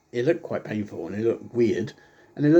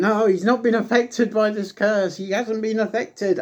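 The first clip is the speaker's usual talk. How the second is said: higher insistent mock commentator; lightly voiced insistent commentators; imitating commentators; 8 semitones higher than usual. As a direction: deny it like a TV commentator. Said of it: "they were, like, going".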